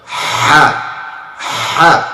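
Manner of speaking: "Ha" from the middle of the throat; a tighter mid-throat "Ha". Shown as a demonstration. Teacher showing the middle-throat letter. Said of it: "Now it's from the middle".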